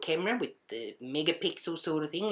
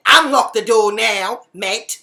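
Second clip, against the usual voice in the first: slow, loud, raised command; shouted order; 6 semitones higher, 14 dB louder, slower than usual. Retold or imitated. Bashing on the bathroom door, shouted angry order. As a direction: shout through a closed door. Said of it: "bashing on the door".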